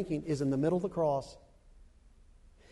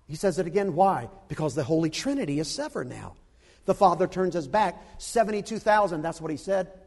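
ordinary talk, nothing marked

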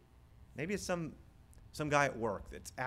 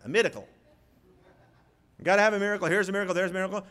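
loud, higher mock televangelist; televangelist accent; 8 semitones higher, 8 dB louder, much faster than usual. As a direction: ham up a TV preacher's miracle pitch. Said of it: "usually in that accent".

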